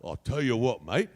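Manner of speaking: low London storyteller accent; Cockney-style accent. Shown as a demonstration. Showing how to add an accent, Cockney-style. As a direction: spin a yarn in Cockney. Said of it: "add an accent".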